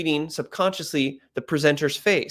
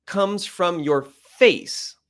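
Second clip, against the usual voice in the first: slow; 3 semitones higher, much slower than usual. Shown as a demonstration. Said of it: "Let me say that again".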